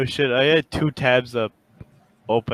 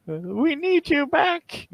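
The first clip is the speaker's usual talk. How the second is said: high, slow pleading; 3 dB quieter, slower than usual. Acted. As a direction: beg them to come back, high and drawn out. Studio begging voice.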